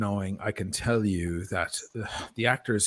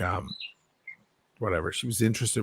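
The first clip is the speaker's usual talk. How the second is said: usual speaking voice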